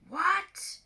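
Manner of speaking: high loud startled cry; outraged disbelief; shocked god. Acted. Fake Ares shocked by Clarice's refusal, high loud cry.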